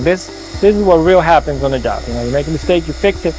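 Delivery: ordinary talk, nothing marked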